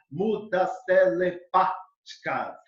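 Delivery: slow, separated syllables; counting syllables. Shown as a demonstration. Teacher demonstrating five-syllable verse, slow syllable counting. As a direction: scan it slowly, beat by beat. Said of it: "com cinco sílabas métricas".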